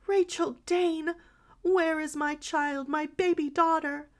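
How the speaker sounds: high, loud and slow desperate demand; wild cry. A narrator's reading. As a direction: cry out wildly for a lost child. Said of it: "cried the other wildly".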